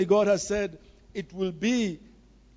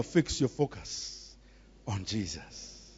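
a lowered, slow cue to pass on; lower, slower; 12 semitones lower, 9 dB quieter, slower than usual. Shown as a demonstration. Preacher giving the congregation words to tell a neighbor, lower and slow. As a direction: drop the voice and say it slowly to your neighbor. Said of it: "Someone tell your neighbor".

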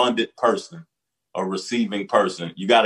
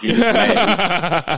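laughing; 11 semitones higher, 5 dB louder, much slower than usual. Real, not acted. Retelling prank, laughing.

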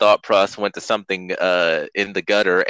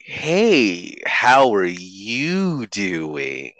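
mock drawn-out greeting; much slower than usual. Friend imitating the host's odd vowel-stretching video voice, slow and put-on. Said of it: "That's how you did it".

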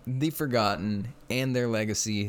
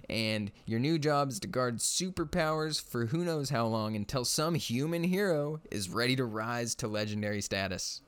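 ordinary talk

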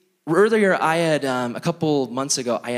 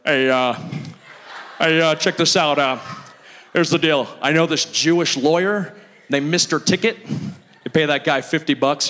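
Kronk voice. Retold or imitated. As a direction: do a lightly voiced big dopey guy. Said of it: "he sounds like Kronk".

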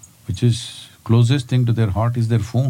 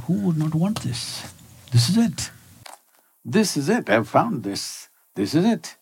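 higher, thrilled, repeating the discovery in wonder; ecstatic discovery; 7 semitones higher, 4 dB quieter than usual. Retold or imitated. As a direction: relive the joy of finding it. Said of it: "I'm ecstatic".

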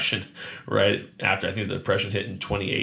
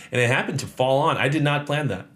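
usual speaking voice

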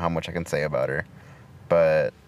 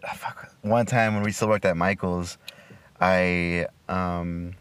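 ordinary talk

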